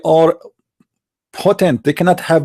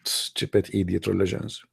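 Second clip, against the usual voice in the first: a low, much softer disgusted aside under the breath; muttered aside; 8 semitones lower, 13 dB quieter than usual. Real, not acted. To himself about Islam, a soft contemptuous mutter. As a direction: drop right down and grumble it off-mic.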